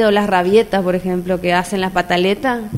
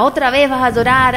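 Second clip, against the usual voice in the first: mother scolding; 4 semitones higher, 5 dB louder than usual. Retold or imitated. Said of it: "en mi casa me decían la llorona".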